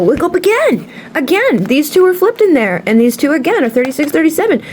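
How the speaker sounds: high, amazed exclaiming; wide-eyed disbelief at flipped cards; surprised. Real, not acted. Reader spotting flipped cards again, surprised.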